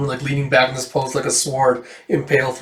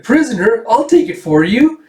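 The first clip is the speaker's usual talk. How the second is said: high, louder eager voice; girlish Erica; 9 semitones higher, 6 dB louder than usual. Acted. Erica offers to take the prisoner's food, high and eager. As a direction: chirp it up like a keen helper.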